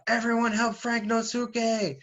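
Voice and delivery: high, drawn-out rallying cry; acted cartoon yell. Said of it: "they yell out".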